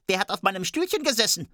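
high, quick little dwarf; squeaky-fast dwarf asking. A narrator's reading. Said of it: "Der erste Zwerg sprach".

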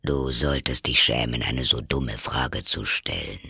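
low Gryphon voice, mild reproof; scolding Gryphon. Acted. Gryphon shames Alice for a dumb question, low and scolding.

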